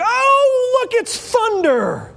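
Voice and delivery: high, dim-witted exclamation; clueless bystander. Pretend. Crowd member mistaking God's voice for thunder, dopey high. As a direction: gasp up high like a clueless onlooker. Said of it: "The first one's like".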